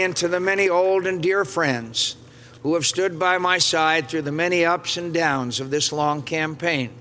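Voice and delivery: ordinary talk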